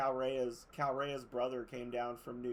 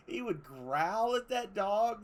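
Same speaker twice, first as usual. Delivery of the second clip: amused excitement; 7 semitones higher, 5 dB louder, slower than usual. Real, not acted. Excited storytelling about cat.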